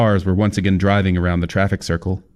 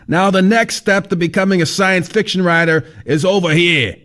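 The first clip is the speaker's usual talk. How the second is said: high, brash know-it-all; raised voice, lecturing; fat man voice; 11 semitones higher than usual. A narrator's reading.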